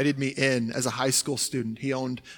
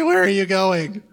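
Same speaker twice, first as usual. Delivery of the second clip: mock panic at money; 5 semitones higher, 7 dB louder than usual.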